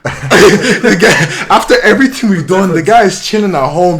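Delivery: loud, high, laughing through the words; incredulous laughter. Real, not acted. Storyteller recalling his friend chilling at home, laughing incredulously. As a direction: tell it while cracking up in disbelief. Laughs at absurd memory while telling.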